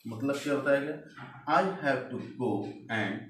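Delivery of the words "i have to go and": slow, careful reading of the example; slow dictation. Shown as a demonstration. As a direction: read it slowly for the class to follow. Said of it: "reading example sentence to class". (धीरे-धीरे उदाहरण पढ़ना)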